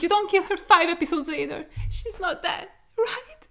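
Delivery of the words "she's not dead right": tearful, breaking denial; pleading through tears, the last word rising high; crying. Real, not acted. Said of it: "I'm gonna stop crying".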